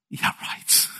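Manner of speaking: soft, voiceless scoff; sarcastic disbelief. Retold or imitated. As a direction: imitate a skeptical husband under the breath. Acted Joseph scoffs at the claim.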